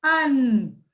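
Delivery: अन as a long falling nasal vowel; sustained nasalised अन; chandrabindu pronunciation. Shown as a demonstration. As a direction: hold अन through the nose. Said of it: "इसका उच्चारण कैसे होता है".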